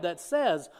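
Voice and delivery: ordinary talk, nothing marked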